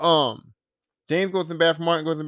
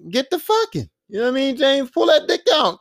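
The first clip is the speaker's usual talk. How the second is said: high-pitched exasperated urging; egging on; 6 semitones higher than usual. Acted. Viewer urging James on in the hotel, egging on. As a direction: push him to get on with it.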